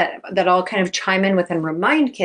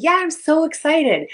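high, eager mock reply; excited answer; 9 semitones higher than usual. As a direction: answer the coach all keyed up. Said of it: "your coach is like".

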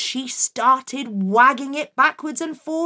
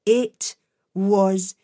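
plain reading voice

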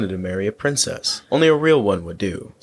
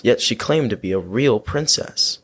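ordinary delivery, nothing marked

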